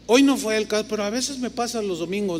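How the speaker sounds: ordinary delivery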